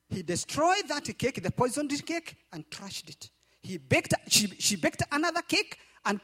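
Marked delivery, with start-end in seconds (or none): none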